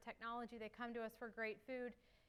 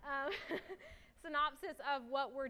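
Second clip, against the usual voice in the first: laughing; 4 semitones higher, 7 dB louder than usual. Real, not acted. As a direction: laugh through the words. Laughs at own breathlessness.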